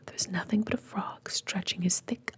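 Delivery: ordinary delivery, nothing marked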